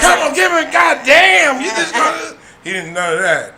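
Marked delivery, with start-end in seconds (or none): 0.0-2.4 high, loud protest; hammed-up indignant yell; mock outraged shout. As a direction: yell it like a cheated gambler. Mock outrage at a dice game.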